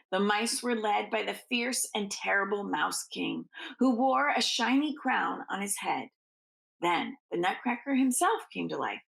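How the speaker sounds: usual speaking voice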